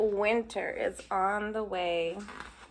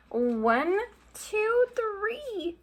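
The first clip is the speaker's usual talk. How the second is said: rising countdown; 9 semitones higher, much slower than usual. Acted. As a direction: count up, climbing high. Countdown before mouse pops out.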